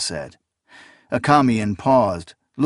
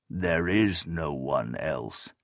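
grave, slow; 4 semitones lower, 7 dB quieter, slower than usual. A narrator's reading. Old teacher's flat refusal.

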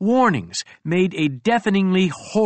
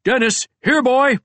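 high, raised screech calling a dog; 9 semitones higher, 4 dB louder than usual. A narrator's reading. Crow narrator summons Dennis over the bear's head, high screech. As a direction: call out high and urgent, a half-screech. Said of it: "I screeched".

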